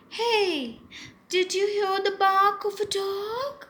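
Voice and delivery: high, loud alarmed question; scared parrot. Acted. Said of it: "She was scared".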